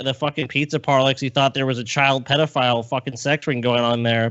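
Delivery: ordinary talk, nothing marked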